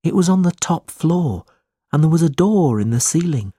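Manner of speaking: high, uneasy recollection; fearful, troubled. A narrator's reading. Smike remembering the attic door, fearful. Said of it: "his voice shook as he spoke".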